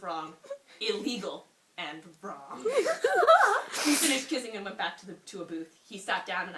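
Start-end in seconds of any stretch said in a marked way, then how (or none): 2.7-3.8 high burst of laughter; shrieking laugh. Real, not acted. Cracks up reading.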